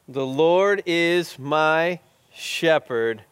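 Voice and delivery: very slow, each word drawn out; slow recitation. Shown as a demonstration. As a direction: recite it slowly so they can chime in. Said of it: "I'll say it slowly".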